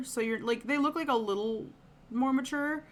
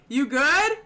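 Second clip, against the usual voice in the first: loud call; 4 semitones higher, 9 dB louder than usual. Retold or imitated. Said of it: "I can hear Nico".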